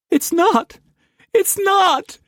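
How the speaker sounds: very high, desperate cry; weeping. Acted. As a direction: cry it out in despair. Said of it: "She wept uncontrollably".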